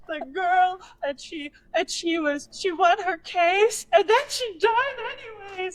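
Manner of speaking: high, wailing retelling; mock tearful explanation. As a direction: wail it out as if bawling. Acts out explaining while bawling.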